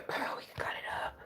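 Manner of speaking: hushed unvoiced whisper to the camera. Real, not acted. Cutting up an old card for viewers, whispered. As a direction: keep it all breath, no voice.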